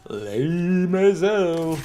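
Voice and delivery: slow, high, hammed-up echo of "Lame as hell"; mock drawn-out repeat. Echoing own complaint, drawn out.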